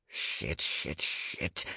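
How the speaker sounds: very soft, near-whispered curses; hushed, panicky cursing under the breath; dread-filled muttering. A narrator's reading. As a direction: mutter it low behind your hand. Muttered dread behind palm.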